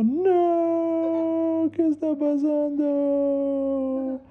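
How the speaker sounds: high, drawn-out wail; crying. Acted. Boy realizing he still speaks Spanish, crying high wail. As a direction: sob out the question slowly. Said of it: "estaba, eh, llorando".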